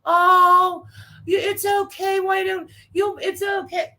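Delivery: high, cooing mock reassurance; babying. Chat members comfort the complainer, mock babying. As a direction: mimic people soothing a fussy person. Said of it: "people in the chat are babying her".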